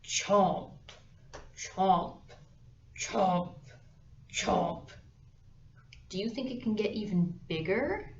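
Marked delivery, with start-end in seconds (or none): 0.0-4.9 snapping teeth. Acted. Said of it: "this big alligator goes".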